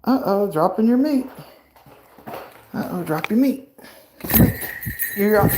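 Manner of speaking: high sing-song talk to the bird. Real, not acted. Baby talk to pet bird.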